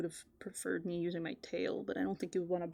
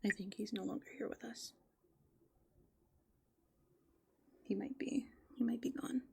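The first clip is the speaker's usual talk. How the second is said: whispering; 2 semitones higher than usual. Real, not acted. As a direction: keep it hushed, barely breathing the words. Hushed talk at night by dumpster.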